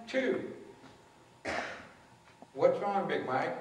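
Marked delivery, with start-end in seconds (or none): 2.5-3.6 calm question, dropping lower at the end. Retold or imitated. Driver turns to Big Mike alone, voice lowered. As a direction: bring it down and ask gently. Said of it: "She lowers her voice".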